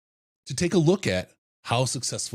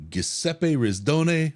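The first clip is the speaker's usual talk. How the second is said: hammed-up Italian name, slow and higher, Rizdone rolled out; mock Italian; 7 semitones higher, much slower than usual. Host introducing his co-host in mock Italian. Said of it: "I've never been Italian before".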